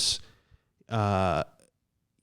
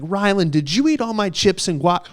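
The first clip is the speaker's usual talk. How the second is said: much higher, accusing question; indignant confrontation; 8 semitones higher than usual. Retold or imitated. To roommate Rylan about his eaten food, mock indignant. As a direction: call out the roommate over the missing food.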